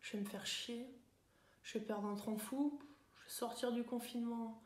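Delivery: mope quietly through a list of worries; gloomy grumbling. Acted. Thinking of uncool things in lockdown, gloomy. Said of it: "penser à des trucs pas cool".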